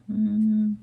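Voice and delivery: ordinary talk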